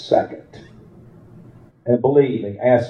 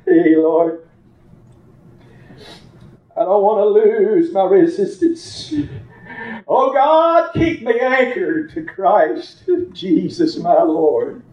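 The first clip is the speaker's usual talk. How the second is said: raised, pressing preacher voice; preaching; 6 semitones higher than usual. Acted. Preacher urging the congregation to hold on, raised voice. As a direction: drive each line at the congregation.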